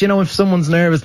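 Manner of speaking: ordinary delivery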